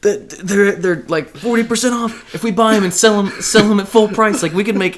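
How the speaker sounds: high, loud manic scheming; freaking out. Acted. Rick and Morty flashback, busting into the house, freaking out. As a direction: pitch it high like a frantic schemer. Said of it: "Where he freaks out".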